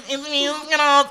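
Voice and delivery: very high squeaky drag persona; greeting fans in her high drag voice. Pretend. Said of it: "That's my drag voice".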